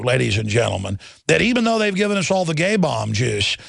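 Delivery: normal talking voice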